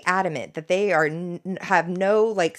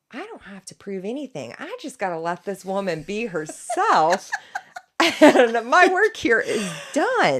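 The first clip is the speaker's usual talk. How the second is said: smug lawyer; 2 semitones higher than usual. Retold or imitated. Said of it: "Rusty was like".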